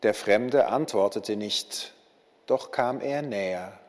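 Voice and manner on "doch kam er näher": ordinary delivery, nothing marked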